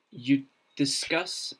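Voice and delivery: ordinary talk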